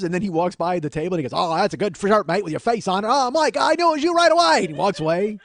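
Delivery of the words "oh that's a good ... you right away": imitated Australian guy, high and loud; Australian accent. Australian stranger recognizing the man by his shirt, loud accent. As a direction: play a chummy Australian calling out. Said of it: "accosted by an Australian guy".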